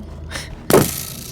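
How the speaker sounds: short burst of laughter; laughing out loud. Real, not acted. Laughs after the drawl.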